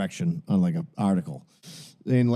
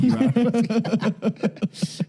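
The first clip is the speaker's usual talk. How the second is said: much higher, slow drawn-out taunt; mocking taunt; 12 semitones higher, much slower than usual. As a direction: stretch it out high to mock him. Mocking repeat of the insult.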